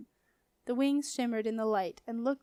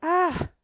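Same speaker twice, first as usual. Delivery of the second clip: sharp high gasp of shock; surprised splutter; loud gasp; 8 semitones higher, 9 dB louder than usual. A narrator's reading. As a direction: gasp as if swallowing mud. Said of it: "gasped Boy four one two".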